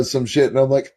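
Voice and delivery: usual speaking voice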